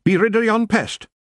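very high Scots clansman; high, combative Scottish voice; feisty Rob. A narrator's reading. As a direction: urge it eagerly in broad Scots. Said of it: "said Rob".

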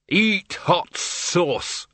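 slow, high, drawn-out mock roar; hammed-up roar. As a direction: bellow it like a triumphant old wizard. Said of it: "roared the Arch-Chancellor".

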